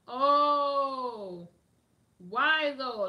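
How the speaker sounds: loud, high, stunned oh; loud surprise. Real, not acted. Reacting in disbelief to news from Mo Bay. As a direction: blurt it out in disbelief.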